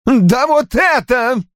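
high, drawn-out cry; furious yell. A narrator's reading. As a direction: yell it in exasperated rage. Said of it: "завопил он". (яростный вопль)

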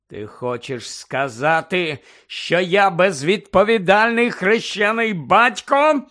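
slow, rising indignant question; loud, high, offended challenge; almost shouting. A narrator's reading. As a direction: build slowly toward a shout. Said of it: "Сіріус ледь не кричав".

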